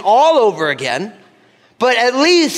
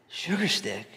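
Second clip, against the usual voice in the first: very soft, puzzled murmur to himself; quietly insecure, as if thinking it privately; unsure, insecure; 13 dB quieter, slower than usual. Retold or imitated. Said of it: "getting really uncomfortably insecure".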